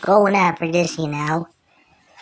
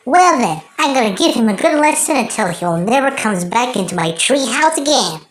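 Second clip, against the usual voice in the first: usual speaking voice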